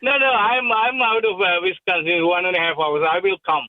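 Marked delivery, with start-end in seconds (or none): none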